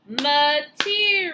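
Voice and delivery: Material split into slow separate syllables, each one punched; clapped syllables. Shown as a demonstration. Teacher clapping syllables with the girls, slow and split. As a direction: stretch out Material, one beat per syllable. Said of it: "Let's do it again".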